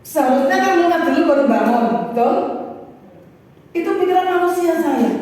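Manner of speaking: high, loud, insistent pulpit delivery; forceful preaching. Acted. Preacher telling congregation of his doubts over the land swap, forceful preaching. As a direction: drive home the amazement.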